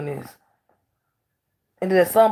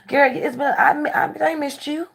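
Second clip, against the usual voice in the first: higher, put-on sweet greeting; mock fake greeting; 11 semitones higher, 4 dB louder than usual. Mimicking the fake hugs she refuses, sugary and higher. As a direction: act out a phony hello to a relative.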